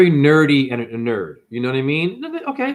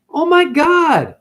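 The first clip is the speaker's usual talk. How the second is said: slow, high, exasperated oh my God; drawn-out disbelief; 13 semitones higher, 5 dB louder, slower than usual. Real, not acted. Ranting in disbelief.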